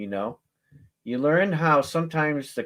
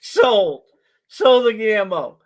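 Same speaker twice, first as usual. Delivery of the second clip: higher, louder sold announcement; loud sold call; 6 semitones higher, 8 dB louder, slower than usual. Real, not acted. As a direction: close the sale with a bang. Auctioneer closing the sale.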